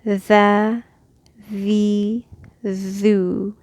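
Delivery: the, the, thu held for two beats; the, the, thu elongated; two-beat vowels. Shown as a demonstration. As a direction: stretch each vowel. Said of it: "two harakat".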